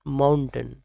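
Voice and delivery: mountain with a short "tun" ending; correct pronunciation. Shown as a demonstration. Teacher repeats the right version, correct pronunciation. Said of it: "right one is mountain".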